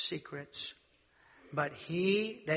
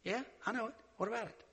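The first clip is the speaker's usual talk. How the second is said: Elisha's retort; 6 semitones higher, 3 dB quieter, faster than usual. Retold or imitated. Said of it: "He said".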